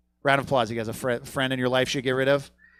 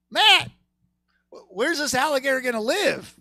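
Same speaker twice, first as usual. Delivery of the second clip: sudden worry; 9 semitones higher than usual. Retold or imitated. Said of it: "that's when I go".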